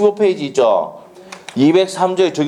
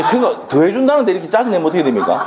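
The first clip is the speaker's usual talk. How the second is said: quick, high-pitched amused protest; playful chiding; 6 semitones higher, faster than usual. Real, not acted. Teacher to students grumbling about extra classes, playful chiding. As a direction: protest jokingly in a raised, hurried pitch. Joking scolding of annoyed students.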